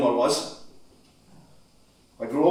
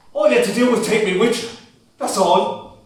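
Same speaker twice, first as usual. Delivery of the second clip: higher, louder, breaking reproach; son's grief bursting out at his father; anguished cry; 6 semitones higher, 4 dB louder than usual. Acted. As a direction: cry it out at him.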